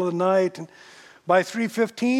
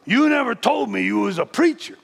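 louder, challenging edge; trace of hostility; 4 semitones higher, 4 dB louder than usual. Retold or imitated. Diner owner challenging the pastor after the prayer, mildly hostile. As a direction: confront him with mild suspicion. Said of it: "a trace of hostility in his voice".

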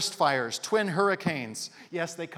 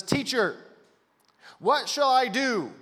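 loud, higher, declaimed question; lawyer challenging the teacher; 7 semitones higher, 8 dB louder, slower than usual. Acted. Lawyer putting Jesus to the test, loudly declaimed challenge. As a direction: call it out slowly as a formal challenge.

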